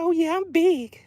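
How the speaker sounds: high, put-on voice of a big fish; talking fish. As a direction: pipe up as the proud fish. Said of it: "She's like".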